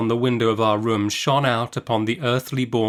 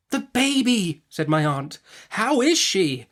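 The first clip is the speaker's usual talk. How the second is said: much higher, drawn-out, demanding; sharply; 9 semitones higher, slower than usual. A narrator's reading. The aunt demands news of the baby girl, sharply. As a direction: press him hard for the answer.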